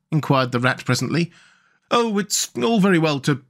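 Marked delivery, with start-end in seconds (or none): 1.9-3.4 higher, put-on peevish voice; petulant little animal complaint; pettish. A narrator's reading. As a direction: grumble it pettishly as a character. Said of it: "said the Mole rather pettishly".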